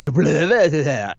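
spluttered tongue-flapping noise; imitated splattering noise. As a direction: make a messy burst of noise. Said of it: "It just kinda went".

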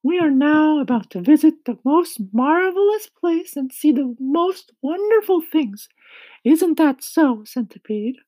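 high-pitched, loud character voice; kindly ladybug. A narrator's reading. Ladybug telling James of their departure, high, loud voice. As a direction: speak up high as an eager ladybug.

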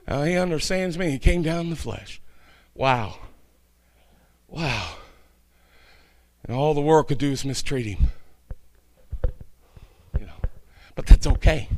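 very soft and low, with pauses; subdued preacher musing after the climax; hushed reflection. Real, not acted. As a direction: drop to an awed, quiet murmur.

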